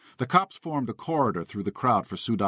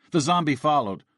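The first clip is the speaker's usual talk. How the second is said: plain reading voice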